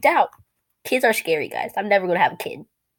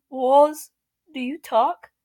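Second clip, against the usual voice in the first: usual speaking voice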